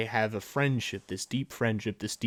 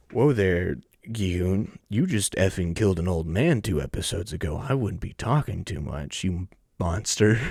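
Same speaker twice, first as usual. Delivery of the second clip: louder, lower, scolding a cartoon villain; scolding Gi-hoon; 5 semitones lower, 4 dB louder than usual. Real, not acted. Vlogger telling off Gi-hoon for hypocrisy, loud low scolding. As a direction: tell him off with mock outrage.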